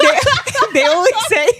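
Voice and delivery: high, loud words breaking into laughter; laughing. Real, not acted. Cracking up over their own accent impression, laughing. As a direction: crack up while trying to explain.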